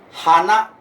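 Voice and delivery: Kanto-accent "hana", rising on na. Shown as a demonstration. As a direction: lift the end of "hana", as in Kanto. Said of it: "itu di Jepang kan bunga". (logat Kanto, "hana" naik di akhir)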